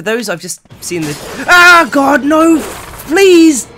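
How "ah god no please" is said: much higher, loud terrified cries; screaming as dogs chase him; panicked screaming. Real, not acted. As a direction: scream for mercy.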